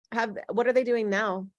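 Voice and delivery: usual speaking voice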